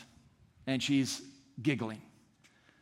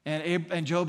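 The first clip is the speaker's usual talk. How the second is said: ordinary talk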